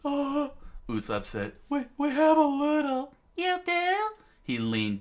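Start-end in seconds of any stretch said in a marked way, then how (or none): 0.0-0.5 very high, loud falsetto. A narrator's reading. Usopp hesitates before the giant, nervous squeak. 1.7-3.1 very high, loud falsetto stammer. A narrator's reading. Usopp admits to the giant about ale, nervous squeak. 3.4-4.0 very high, loud falsetto question; giant's delighted squeak. A narrator's reading. Giant hears there is ale, delighted high voice. Giant reacts with happy face.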